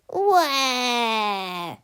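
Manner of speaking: loud cartoon wail; mock wail sob. A narrator's reading. Melvin wailing over lost powers, mock sob. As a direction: wail like a spoilt child. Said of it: "sobbed Melvin".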